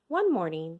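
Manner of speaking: ordinary delivery, nothing marked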